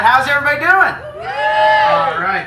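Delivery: loud show-host call to the crowd; crowd hype shout. Real, not acted. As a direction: pump up the audience, shout it out. Wrestler hyping up the crowd.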